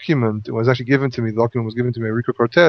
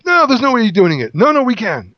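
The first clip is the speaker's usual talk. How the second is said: higher-pitched quick insistence; mock back-and-forth protest; 7 semitones higher than usual. Joshua and the people argue it out, mock protesting. Acting out the rhetorical argument.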